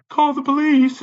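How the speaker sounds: high-pitched parroting of a stock reply; mocking imitation. Mocking what staff always tell him, high-pitched mimicry. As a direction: parrot their line way up high. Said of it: "that's all they ever say to you here".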